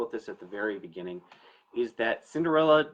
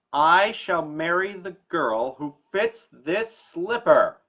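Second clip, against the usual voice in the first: higher, louder, slow princely announcement; a prince declaring his decree slowly and loudly; royal proclamation; 6 semitones higher, 10 dB louder, slower than usual. Acted. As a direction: proclaim it grandly to the whole kingdom.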